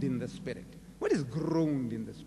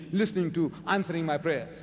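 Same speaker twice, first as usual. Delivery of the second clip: normal talking voice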